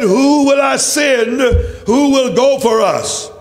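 ordinary talk, nothing marked

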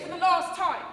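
exasperated. Acted. Clerk refuses claimant one last time, exasperated. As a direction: cut over him, out of patience. Clerk losing patience in quarrel.

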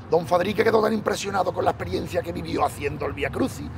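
usual speaking voice

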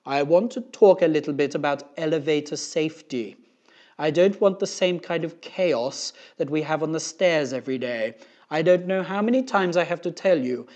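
booming, self-important safety announcement; Mister Kidswatter. A narrator's reading. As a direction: lecture the whole school over a loudspeaker.

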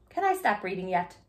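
ordinary delivery, nothing marked

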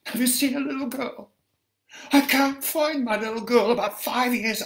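high, fast, panicked asking; a mother who has lost her child, pleading; frantic searching mother. Acted. Frantic mother begging a stranger for help, high and fast.

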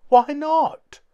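very high, loud wail; high, loud despairing cry. A narrator's reading. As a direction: wail it out in dismay. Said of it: "wailed Doohickey".

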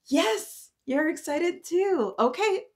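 high, loud, eager yes; match their excitement; excited. Real, not acted. Teacher cheering along with excited kids.

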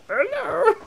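very high, loud puppet squawk; hammed-up high cartoon greeting; Muppet voice. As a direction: imitate a puppet popping open. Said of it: "open up like a Muppet".

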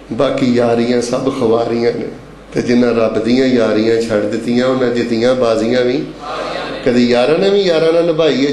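low, measured poem delivery; low-pitched rhythmic verse; verse recitation. Real, not acted.

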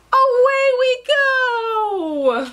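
high, long drawn-out cheer; joyful send-off. Acted. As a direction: sing it out slowly as you lift off. Takeoff refrain.

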